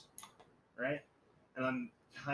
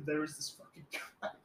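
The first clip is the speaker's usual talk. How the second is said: ordinary talk, nothing marked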